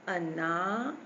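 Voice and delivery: अनार with "naa" stretched out; long middle vowel. Shown as a demonstration. Teacher showing the aa matra takes time, drawn-out vowel. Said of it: "ना पर हमको time लगा ना".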